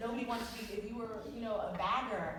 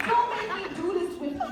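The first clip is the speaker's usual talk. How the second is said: mock warning; 10 semitones higher, 9 dB louder than usual. Mock threat to name-changer.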